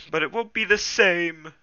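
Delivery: high, loud acted wail. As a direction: wail it to a friend who is leaving. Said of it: "Smudge wailed".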